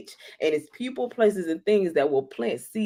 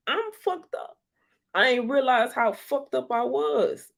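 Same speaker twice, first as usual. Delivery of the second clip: squealed realization; 8 semitones higher than usual. Retold or imitated. Continuing the outburst.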